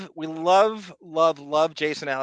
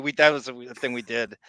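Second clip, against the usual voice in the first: ordinary talk, nothing marked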